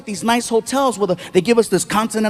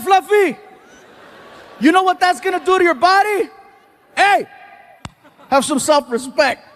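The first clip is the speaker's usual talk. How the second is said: mock yelling friend; 7 semitones higher than usual. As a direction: yell it across the restaurant, hammed up. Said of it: "he starts yelling".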